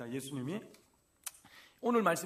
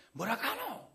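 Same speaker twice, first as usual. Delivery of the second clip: sharp, higher Gyeongsang dialect retort; Gyeongsang bark; 6 semitones higher, slower than usual. Retold or imitated. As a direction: snap it out like a Gyeongsang in-law. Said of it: "경상도가 원래 세요". (경상도 사투리로 툭 쏘듯이)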